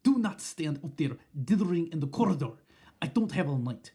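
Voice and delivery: loud, impatient command; snapped. Acted. Witch ordering the hesitant witches in, loud and impatient. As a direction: snap them inside. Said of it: "snapped the Grand High Witch".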